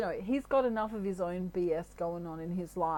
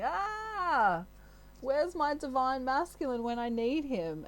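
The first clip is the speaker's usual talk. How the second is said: high-pitched wailing mock scream; mock screamy wail; 5 semitones higher than usual. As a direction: wail it out like a hammed-up damsel in distress. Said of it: "she sang that screamy song".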